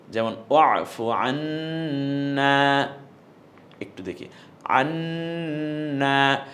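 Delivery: slow, stretched "আন্না" with the alif held; long alif drawn out. Shown as a demonstration. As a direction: draw out the final vowel of "আন্না" one alif. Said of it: "এক আলিফ টেনে বলতে হবে".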